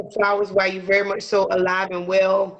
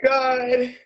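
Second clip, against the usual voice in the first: drawn-out laughing oh my god; 3 semitones higher, much slower than usual. Real, not acted.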